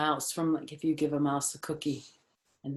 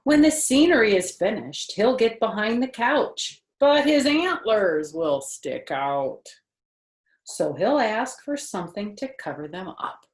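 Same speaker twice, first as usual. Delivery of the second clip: higher, louder storybook voice; reading to children; 6 semitones higher, 8 dB louder than usual. Acted. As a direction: read it brightly to a little listener. Reading picture book aloud.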